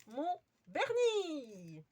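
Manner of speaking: drawn-out playful call. Acted. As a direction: stretch the name out like a cheerful rallying cry.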